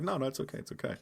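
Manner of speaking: soft mock reassurance. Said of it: "Mark Ruffalo is like".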